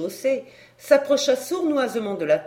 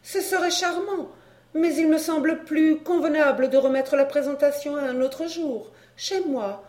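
higher-pitched, polite lady; courteous regrets in a higher lady's voice; foreign countess; 6 semitones higher than usual. Acted.

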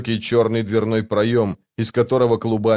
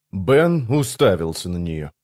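usual speaking voice

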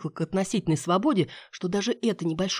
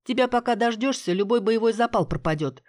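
grumbling; 6 semitones higher than usual. A narrator's reading. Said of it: "проворчала я".

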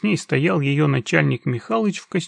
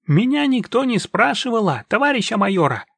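put-on high, sly Chukchi-Russian accent; broken Chukchi-Russian accent; 8 semitones higher, 3 dB louder than usual. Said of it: "переходя на русско-чукотский".

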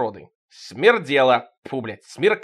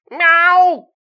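loud, very high meow; cat meow; 11 dB louder than usual. Acted. The cat Tikhon meowing, loud and high. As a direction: yowl like a cat.

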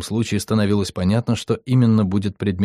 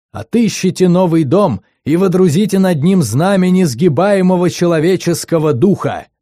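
громкий пафосный призыв, медленно; воодушеви, как диктор на параде; торжественно и громко; 4 semitones higher, 4 dB louder than usual. Acted. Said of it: "стал торжественным и волнующим". (пафосный громкий диктор)

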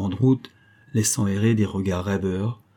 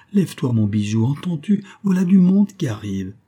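rising, high-pitched wake-up call; urgent coaxing; 5 semitones higher, 4 dB louder than usual. Acted. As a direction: rouse a dozing husband urgently. Wife rousing her sleeping husband.

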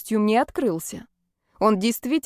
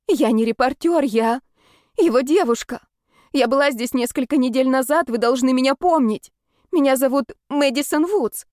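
higher, louder urgent plea; desperate pleading; 5 semitones higher, 3 dB louder than usual. Acted. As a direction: beg to be let in.

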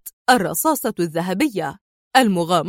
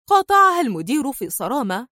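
plain reading voice

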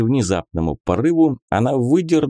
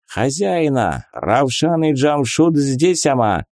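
broken-Russian migrant worker voice; 4 semitones higher than usual. Acted. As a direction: Ravshan from Nasha Russia. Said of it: "Ravshan and Jamshut characters".

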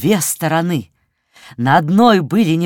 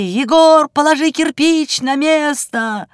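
высокий окрик бабушки-сирены; окликать высоко и протяжно, как сирена; крик как сирена; 9 semitones higher than usual. A narrator's reading. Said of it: "кричала, как пожарная сирена". (высокий бабушкин крик)

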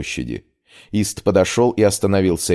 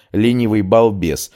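ordinary delivery

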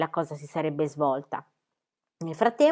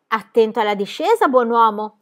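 louder, playful warning; girl's teasing exclamation; mock warning exclamation; 3 semitones higher, 7 dB louder than usual. A narrator's reading. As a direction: cheerfully warn him as he flies. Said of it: "esclamò Pippi".

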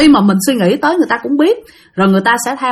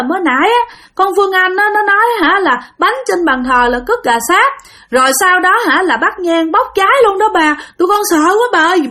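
mock crying; 9 semitones higher than usual. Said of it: "vừa khóc vừa nói".

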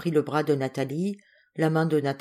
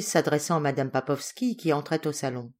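plain reading voice

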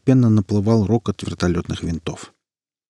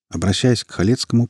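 ordinary delivery

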